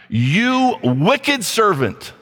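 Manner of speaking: very slow, heavy stress on "wicked"; the master condemning his servant, slow and weighty; stern rebuke. Acted. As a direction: drag out "You wicked servant" word by word like a verdict.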